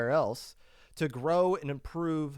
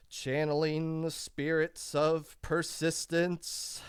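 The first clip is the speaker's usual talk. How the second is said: seance medium; 4 semitones higher, slower than usual. Pretend. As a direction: intone it like a wizard casting, rising to a shout. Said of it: "Let me get my I P L wand".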